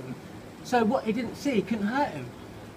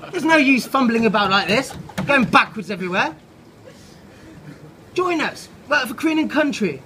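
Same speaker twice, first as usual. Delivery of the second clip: higher, louder brisk military voice; pompous army officer; 5 semitones higher, 4 dB louder than usual. Acted. Ant officer grilling Cynthia about Norman, brisk and loud. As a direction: bark questions like a stiff officer.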